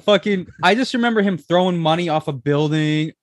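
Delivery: loud, high and excited; bursting with excitement. Real, not acted. Excited about remembered music video.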